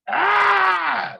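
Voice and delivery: short loud high frustrated yell; angry yell. Retold or imitated. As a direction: bark out a raw ah. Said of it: "now I'm going fucking, I'm".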